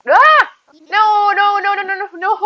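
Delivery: high no no no laughing; panicked cry. Real, not acted. Game mishap panic.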